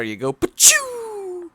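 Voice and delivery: high, slow cartoon zoom; whoosh sound effect. Shown as a demonstration. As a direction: launch it like a water blast. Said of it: "that's what happens when you get in the water".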